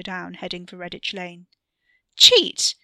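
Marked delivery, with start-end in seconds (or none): 2.2-2.7 loud, high indignant yell; shout. A narrator's reading. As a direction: shout it at him, playfully outraged. Katie yells at cheating Ricky.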